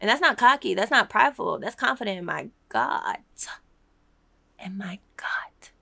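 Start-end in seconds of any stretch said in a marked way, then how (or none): 4.6-5.7 soft breathy whisper to herself. Real, not acted. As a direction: breathe the words out, unvoiced. Whispered aside after preaching.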